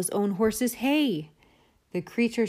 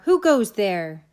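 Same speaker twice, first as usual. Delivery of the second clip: rat's challenge; 2 semitones higher, 5 dB louder, slower than usual. Acted. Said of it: "who cried out".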